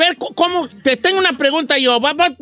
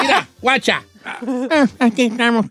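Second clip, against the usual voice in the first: croaky old man imitation. Shown as a demonstration. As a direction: talk like a grumpy old man. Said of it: "Sé hacer voz de viejito".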